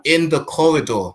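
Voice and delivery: loud, incredulous interjection; appalled emphasis. Real, not acted. Host reacting to a hospital death, appalled. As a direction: hit it hard, in disbelief.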